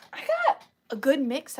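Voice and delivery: usual speaking voice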